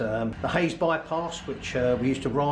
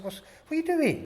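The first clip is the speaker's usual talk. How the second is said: surprised question; 3 dB louder than usual. Retold or imitated. Retold finish official's question.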